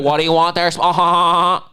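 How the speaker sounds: ape her sneering tone, pitched high; mocking rude waitress. Teller mimics rude waitress snubbing veteran, high mock sneer. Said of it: "imitating and mocking it".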